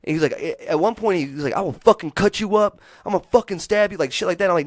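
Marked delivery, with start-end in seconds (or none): 1.5-4.0 higher and louder threatening yell; retold threat. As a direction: bark the threat the way he did.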